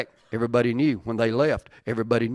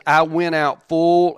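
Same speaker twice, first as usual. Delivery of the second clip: slowed, louder I went out full; slow emphatic reading; 3 semitones higher, 6 dB louder, slower than usual. Acted. Preacher reading Naomi's lament, slow and emphatic. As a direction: draw it out with weight.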